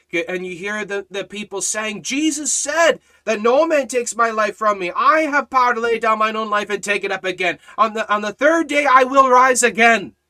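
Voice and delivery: rising, loud revival preaching with voice climbing high; full-force preaching. Acted. Preacher building the scene of the cross for the congregation, thundering. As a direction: preach it to the crowd, building until you're shouting. Preacher quoting the people's words.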